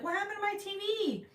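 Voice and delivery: high mock wail; hammed-up high-pitched alarm; dismayed mom. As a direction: imitate a mom crying out in dismay. Retold mom shocked TV is off.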